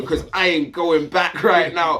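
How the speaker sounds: high, loud warning to behave; urgent scolding. Retold or imitated. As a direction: hiss it at him like a desperate order. Scolding drunk fellow prisoner.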